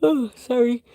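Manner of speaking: talking through a yawn. Real, not acted. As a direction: say it mid-yawn. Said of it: "Sorry, sorry for yawning".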